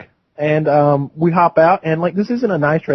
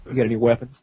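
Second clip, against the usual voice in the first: low, softer straight-faced question; deadpan; 5 semitones lower, 5 dB quieter, faster than usual. Retold or imitated. Uncle repeating his question to the nephews, low deadpan. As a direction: drop it low and ask it dead serious. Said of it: "Like, straight face".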